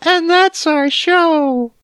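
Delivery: put-on very high, slow squeaky kid voice; sweet golly-gee sign-off; wholesome 1950s kid. [name] closes in squeaky voice.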